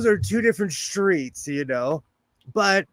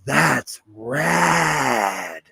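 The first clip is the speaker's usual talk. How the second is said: long drawn-out RAD, sinking low; awed slang impression; 5 semitones lower than usual. Shown as a demonstration. Demonstrating hometown slang to viewers, drawn-out mock awe. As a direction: stretch it out like an awestruck local. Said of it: "people do not talk like that".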